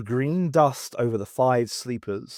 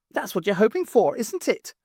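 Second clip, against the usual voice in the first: high, quick, teasing; teasing cook voice; 9 semitones higher than usual. Acted. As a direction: needle her, quickly. Nora teasing Maisie.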